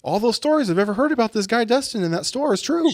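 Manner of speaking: a lightly voiced upset customer; agitated retold rant. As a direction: vent like the shaken customer. Customer venting, quoted.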